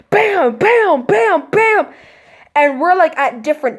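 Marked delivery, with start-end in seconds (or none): 0.1-1.9 high, ringing "bam" for each hit; punchy mock impact sounds; imitated banging. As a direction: voice the thuds as evenly repeated exclamations. Imitating heads banging on door.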